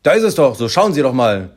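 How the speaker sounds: mock irritated retort, punched out loud; ruppig, gruff. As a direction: impatiently brush off a customer, acted out. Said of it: "die war so voll ruppig".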